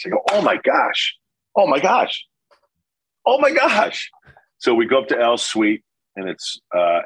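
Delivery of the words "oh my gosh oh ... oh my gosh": stunned, climbing high in pitch with each repeat; retold astonishment. Player retelling his reaction to being traded, astonished. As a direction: act out rising disbelief at big news.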